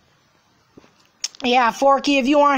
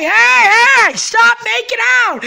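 angry yell; 9 semitones higher, 6 dB louder than usual. Acted. Yelling at kissing couple.

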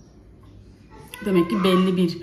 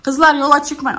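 loud warning call, a bit higher; raised-voice warning from the doorway; 6 semitones higher, 8 dB louder than usual. Real, not acted. Mother calls kids outside.

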